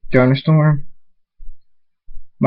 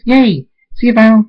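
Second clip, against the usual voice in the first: high, loud gleeful squeal; excited cheer; 10 semitones higher, 6 dB louder than usual. Acted. Party pony saying bye to Vinyl, excited. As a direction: cheer it out, pitched way up. Pinkie cheers, says goodbye.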